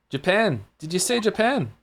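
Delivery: high, incredulous mock Scot; attempted Scottish accent. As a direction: question it in a rough Scottish accent. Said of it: "I can't do a Scottish accent".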